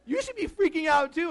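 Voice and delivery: high, loud, worked-up demand; panicking. Acted. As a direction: insist frantically that they join your panic. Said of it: "when I'm panicking".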